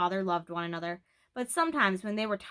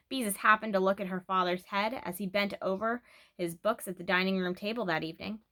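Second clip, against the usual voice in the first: ordinary delivery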